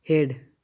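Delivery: हेड said firmly, a not heard; silent a demo. Shown as a demonstration. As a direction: model हेड clearly, no a sound. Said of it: "ए silent हो गया". (हेड को ज़ोर से, ए बिना, बोलिए)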